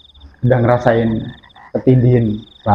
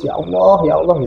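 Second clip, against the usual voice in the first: higher, mildly pleading cry to God; retold tearful surrender; 5 semitones higher than usual. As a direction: imitate a helpless, tearful prayer lightly. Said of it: "Akhirnya saya pasrah, nangis".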